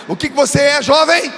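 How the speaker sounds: preacher demanding a stronger answer from the youth, loud call. Shown as a demonstration. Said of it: "Não senti firmeza".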